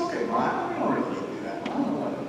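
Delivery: whispered, high reluctant reply to mom; breathy refusal. Retold or imitated. Son whispering no thanks to his mother. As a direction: brush off the offer under your breath.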